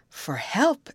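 high, rising echo question; surprised exclamation. A narrator's reading. Betty reacts to the youth seeking help, surprised exclamation. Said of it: "exclaimed Betty".

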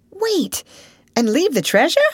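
high, loud disbelief; surprised. Acted. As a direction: yelp it in shock. Tom startled, incredulous.

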